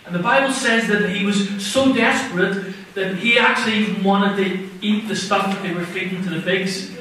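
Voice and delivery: ordinary talk, nothing marked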